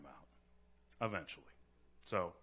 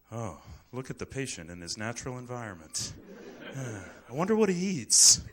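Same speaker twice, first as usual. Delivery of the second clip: lightly voiced nature-documentary host, louder at the end; mock zoo guide; 6 dB louder than usual. Doctor mocking the zoo approach, a light tour-guide voice. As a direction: marvel at the patient like an exhibit, half in jest. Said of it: "what I call the zoo approach".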